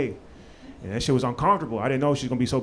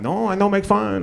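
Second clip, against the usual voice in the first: higher, slower mock Bolivian accent; mimicked Bolivian accent; 4 semitones higher than usual. Son denying mockery to mom, copied Bolivian accent. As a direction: deny it in her own Bolivian accent. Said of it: "exact same accent".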